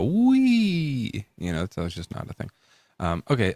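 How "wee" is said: squealed high wee; a gleeful little squeal; mock glee. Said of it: "and you go".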